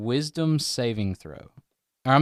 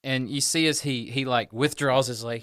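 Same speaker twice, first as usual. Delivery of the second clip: ordinary talk, nothing marked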